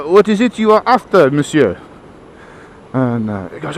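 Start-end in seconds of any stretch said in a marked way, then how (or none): 0.0-1.8 put-on French shopkeeper's accented question; caricature French accent. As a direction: serve the customer in mock French accent. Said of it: "portrayal of French people".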